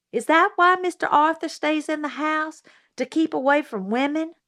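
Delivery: put-on a child puzzling it out aloud; curious girl Scout. A narrator's reading. Scout asking Miss Maudie.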